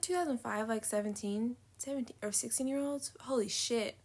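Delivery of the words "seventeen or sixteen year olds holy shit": shocked whisper. Real, not acted. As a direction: whisper it in disbelief. Shocked aside about teen ages.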